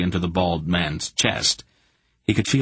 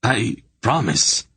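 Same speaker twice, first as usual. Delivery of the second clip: slow, higher, faltering promise; frightened stammer; 5 semitones higher, slower than usual. A narrator's reading. Hierarch stammering a promise to Garion, frightened. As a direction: stumble over the promise in fear. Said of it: "he stammered".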